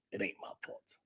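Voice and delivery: breathy aside whisper. Real, not acted. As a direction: drop it to a whisper.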